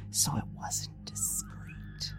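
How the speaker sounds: slow, high whispered aside. Acted. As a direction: whisper it like a sly secret.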